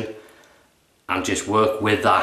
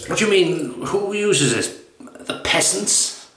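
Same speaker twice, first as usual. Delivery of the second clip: much higher, slow, incredulous snob; shaving snob; 8 semitones higher, slower than usual. Retold or imitated. Snob asking his butler about the soap, mock outrage. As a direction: drag it out in disbelief. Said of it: "the shaving snob".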